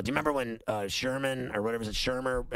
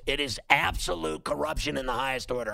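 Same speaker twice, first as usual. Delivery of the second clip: ordinary delivery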